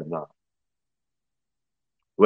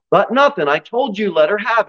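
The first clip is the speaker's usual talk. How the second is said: higher, sharp insistence from an angry mom; demanding mother; 9 semitones higher, 3 dB louder than usual. Acted. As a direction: cut her off and insist. Mother cuts off the nanny.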